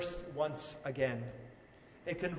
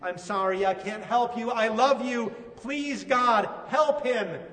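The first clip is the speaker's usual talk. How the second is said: rising, louder plea to God; desperate father; 3 semitones higher, 5 dB louder than usual. Acted. A father praying aloud for his suffering son, increasingly desperate. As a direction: build from apology to urgent begging. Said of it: "imagine the father speaking to his son as well".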